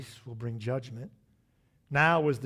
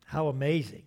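ordinary talk, nothing marked

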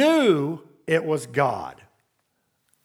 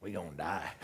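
hushed mock dread; 11 semitones lower, 15 dB quieter than usual. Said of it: "his response was".

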